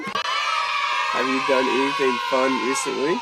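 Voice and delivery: mock game-show announcer call; hammed-up show host shout. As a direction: boom it out as if opening a show. Repeats question like show host.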